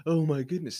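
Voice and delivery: sarcastic pretend shock at the flash mobs; mock alarm. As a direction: fake being appalled, half-hearted. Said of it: "So I have to act like".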